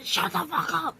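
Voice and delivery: high, loud mock yell at the dog; half-yell it at the dog. Retelling yelling at the dog, high and loud. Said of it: "for saying".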